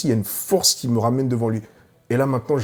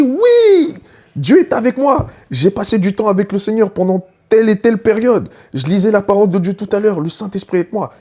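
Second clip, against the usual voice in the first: high, loud, triumphant cry; proclaiming at full voice, much higher; shouting in the dream; 8 semitones higher, 4 dB louder than usual. Retold or imitated. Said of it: "je crie".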